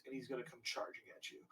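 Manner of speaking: normal talking voice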